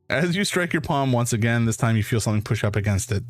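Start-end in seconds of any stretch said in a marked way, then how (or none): none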